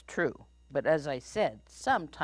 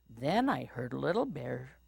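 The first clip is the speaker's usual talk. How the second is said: plain reading voice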